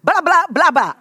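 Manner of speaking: put-on playful babble of a small child; light imitation of a toddler babbling. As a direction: copy the toddler's garbled word. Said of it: "他就讲".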